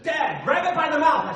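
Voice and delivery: exasperated son urging dad; son's instruction. Retold or imitated. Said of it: "My son specifically said".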